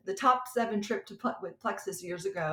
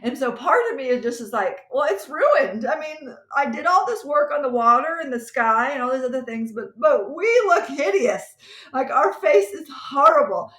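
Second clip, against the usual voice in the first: exasperated; 5 semitones higher, 10 dB louder than usual. Real, not acted. Exasperated over ruined faces.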